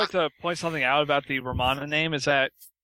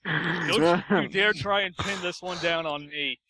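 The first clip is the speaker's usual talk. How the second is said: mock indignant; 2 semitones higher, slower than usual. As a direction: play it like an accused suspect. Mock murder mystery accusation.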